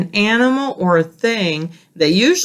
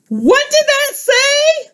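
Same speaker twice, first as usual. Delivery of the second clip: very high, slow, louder alarmed cry; excited Grover; 3 dB louder, slower than usual. Acted. Grover reading the warning aloud, high panicked cry. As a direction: shriek the question in a panic. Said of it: "Grover is really excited and saying it really loud".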